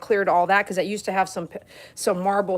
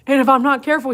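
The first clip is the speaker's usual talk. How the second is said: ordinary delivery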